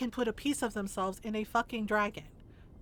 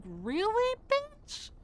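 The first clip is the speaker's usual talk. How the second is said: shrill mock disbelief; 12 semitones higher, much slower than usual. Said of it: "And I'm just like".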